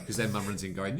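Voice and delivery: ordinary talk, nothing marked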